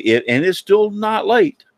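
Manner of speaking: ordinary delivery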